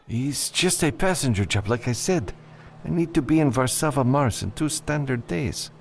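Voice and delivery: put-on high-pitched, nervous Spanish-accented client; nervous client Vicente. Client thanking the freelancer he hired, high and nervous. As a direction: play him high and jittery.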